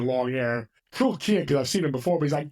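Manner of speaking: usual speaking voice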